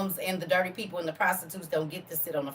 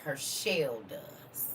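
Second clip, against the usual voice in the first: slow pointed whisper; hushed slow whisper; 4 semitones lower, 8 dB quieter, much slower than usual. Acted. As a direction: breathe it out slowly. Pointed aside after soul line.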